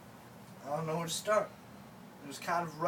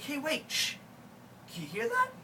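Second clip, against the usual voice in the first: alarmed; 7 semitones higher than usual. Retold or imitated. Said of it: "She's like".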